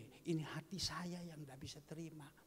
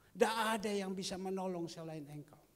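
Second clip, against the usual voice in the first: higher, louder proclamation; proclaimed; 4 semitones higher, 6 dB louder than usual. Shown as a demonstration. (seruan lantang)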